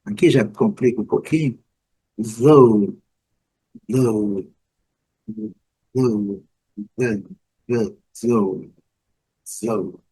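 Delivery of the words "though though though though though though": though as /ðo/, GH silent; English though. Shown as a demonstration. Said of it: "o G H não é pronunciado". (though com TH suave e GH mudo)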